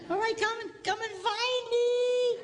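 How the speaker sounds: put-on high, slow sing-song call to the kids; playful calling. Grandpa calls out to hide-and-seek grandsons, high sing-song. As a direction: coax the grandsons to come find him.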